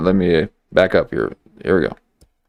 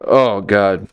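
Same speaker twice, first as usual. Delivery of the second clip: ordinary delivery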